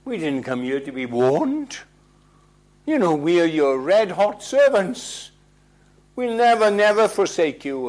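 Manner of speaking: loud, brash boasting, high on never; self-confident boast. Acted. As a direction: swagger like a red-hot servant. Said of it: "they were so self-confident".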